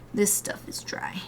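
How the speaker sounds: slow whispered aside; whispering. Real, not acted. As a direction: whisper it to yourself.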